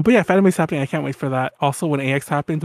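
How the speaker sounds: normal talking voice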